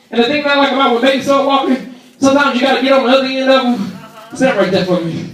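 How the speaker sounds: usual speaking voice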